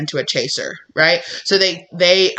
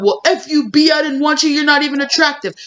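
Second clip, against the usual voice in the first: much higher, sharp indignant retort; pitched-up mock bitter comeback; mock angry retort; 9 semitones higher than usual. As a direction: snap back, hammed-up offended. Imitated bitter reaction to rejection.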